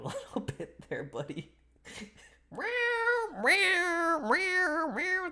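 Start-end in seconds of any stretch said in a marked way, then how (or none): 2.5-5.3 very high cutesy meows; cat meows. Pretend. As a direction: meow like a kitten, high. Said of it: "Thank you for the meow".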